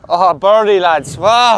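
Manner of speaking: loud, high shout of delight; celebrating a birdie. Real, not acted. Player celebrating his birdie to the lads, loud high shout. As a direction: whoop it out to the lads.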